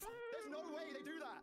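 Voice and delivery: high, drawn-out disbelief; stunned disbelief. Real, not acted. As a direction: stretch each word out in high-pitched amazement. Reacting to the fifty-fifty result.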